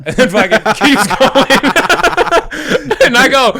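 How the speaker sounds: words squeezed out through laughter, high and loud; can barely get it out for laughing; laughing while telling. Real, not acted. Telling the story to friends, laughing through it.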